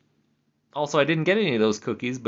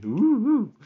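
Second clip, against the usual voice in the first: high ghostly ooh, ooh; a hammed-up spooky ghost sound; 10 semitones higher than usual. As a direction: moan it like a playful ghost. Spooky game at night.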